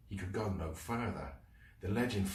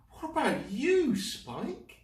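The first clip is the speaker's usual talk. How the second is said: high worried question; concerned; 4 dB louder, slower than usual. Acted. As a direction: turn to a hurt friend.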